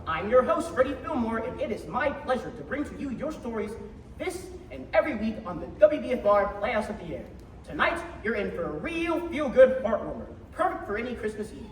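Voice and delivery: usual speaking voice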